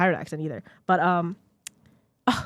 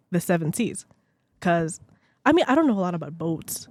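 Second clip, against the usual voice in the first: ordinary talk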